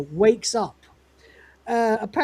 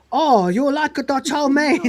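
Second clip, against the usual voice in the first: higher, slow imitated Chinese vendor accent; mock Chinese vendor accent; 5 semitones higher than usual. Vending machine greets the cat in mock Chinese accent. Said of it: "In that voice".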